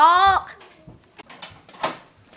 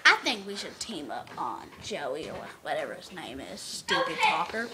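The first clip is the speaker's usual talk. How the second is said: much lower, much softer scheming whisper; conspiratorial whisper; 13 semitones lower, 12 dB quieter than usual. Acted. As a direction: whisper the plan to the horses behind his back. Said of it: "why y'all whispering over there?".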